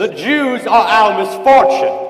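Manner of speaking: loud, high ranting slogan; shouted slogan. Acted. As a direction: hurl it at the crowd like a rally cry. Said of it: "shouting slogans and holding signs".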